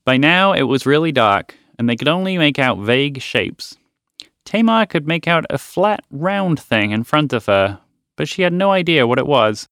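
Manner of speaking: ordinary delivery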